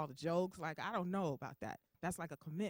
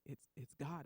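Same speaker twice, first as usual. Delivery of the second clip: low, soft deep voice; God; 5 semitones lower, 8 dB quieter, slower than usual. Acted. Deep voice tells the confused patient who it is, hushed. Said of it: "I hear this deep voice".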